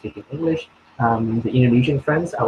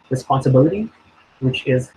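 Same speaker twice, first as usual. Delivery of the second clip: usual speaking voice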